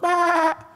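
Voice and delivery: put-on high bleat in answer to the baby; sheep bleat. A sheep answers the crying baby with a bleat. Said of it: "domba langsung menjawab".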